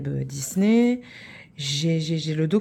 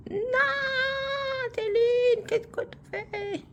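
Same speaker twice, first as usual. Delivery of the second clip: much higher voice, mock telling herself off; self-scolding; slower than usual. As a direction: scold yourself like a fussy mother, high-pitched. Scolding herself mid-task.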